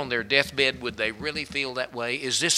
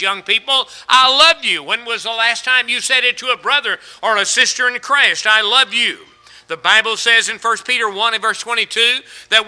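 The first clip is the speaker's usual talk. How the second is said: loud, high shouted sermon; preacher at full cry, loud and high; full-force preaching; 9 semitones higher, 13 dB louder than usual. Acted. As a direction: drive it home to the congregation.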